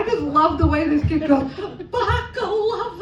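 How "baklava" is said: high, long-drawn singsong word; sing-songy little girl. Retold or imitated. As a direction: mimic a little girl, chanting it slowly. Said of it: "She's a little sing-songy".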